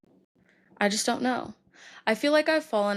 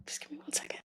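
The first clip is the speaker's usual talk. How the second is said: gasping whisper; 8 semitones higher, 16 dB quieter, faster than usual. Real, not acted. Composing herself mid-cry.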